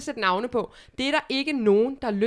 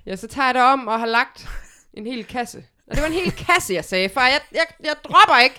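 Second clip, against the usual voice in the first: loud, higher defiant insistence; defiant teen; 4 semitones higher, 6 dB louder than usual. Acted. A teenage girl defies her father, loud and stubborn. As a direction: dig in and refuse to back down.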